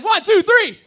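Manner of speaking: loud, high countdown; rally the crowd; crowd count shout. Real, not acted.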